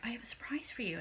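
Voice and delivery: hushed secretive whisper. Retold or imitated. Date whispering his surprise to her, hushed. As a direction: breathe it like a secret. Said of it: "And he's like".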